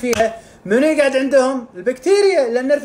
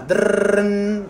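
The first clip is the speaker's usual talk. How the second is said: slow rolling drrrn like a phone ringing; phone ring sound; much slower than usual. Acted. The immune system's phone ringing, imitated trill.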